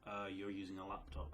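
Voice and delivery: usual speaking voice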